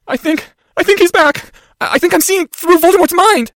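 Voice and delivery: high, loud, halting panic; panicked cry. Acted. Boy seeing through Voldemort's mind, panicked cry. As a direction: cry it out slowly, stumbling in terror. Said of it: "shrieked even louder".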